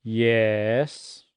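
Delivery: "Yes" dipping low then rising, weary; exasperated teacher. Shown as a demonstration. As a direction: sigh out "Yes" as a fall-rise. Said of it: "she says".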